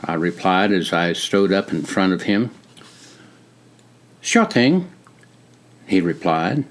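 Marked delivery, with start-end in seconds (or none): 4.2-4.9 put-on higher, crisp British-like accent; friendly greeting in a British-like accent; a soldier greets a visitor in a British-like accent. A narrator's reading. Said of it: "in his crisp, British-like accent".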